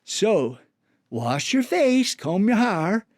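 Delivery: usual speaking voice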